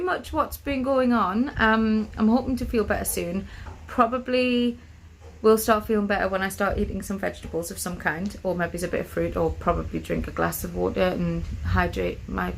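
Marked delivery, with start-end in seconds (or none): none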